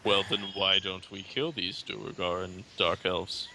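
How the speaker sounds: ordinary delivery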